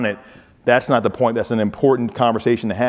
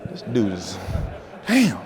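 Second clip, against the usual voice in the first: ordinary talk, nothing marked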